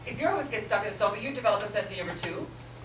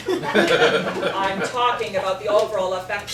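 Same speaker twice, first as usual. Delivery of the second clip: laughing; 8 dB louder, slower than usual. Acted. Laughing retort.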